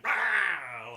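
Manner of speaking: loud imitated metal scream; metal roar. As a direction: roar like a metal band next door. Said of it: "a very gentle voice. And then next door we're going".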